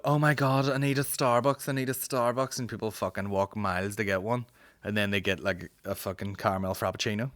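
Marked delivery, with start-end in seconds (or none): 0.0-2.6 whiny coffee addict. Retold or imitated. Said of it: "slightly unnecessary to be like".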